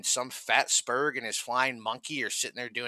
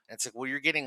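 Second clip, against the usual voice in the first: usual speaking voice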